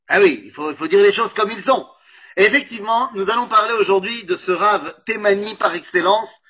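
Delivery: ordinary talk, nothing marked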